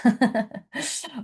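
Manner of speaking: short, high burst of laughter; light laugh. Real, not acted. Teacher amused after sharing a thought, light laugh. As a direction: let out a brief laugh.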